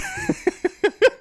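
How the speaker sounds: shrieking laugh dropping away; high squealing laugh. Real, not acted. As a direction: laugh that shoots up high then falls. Laughing at a meme.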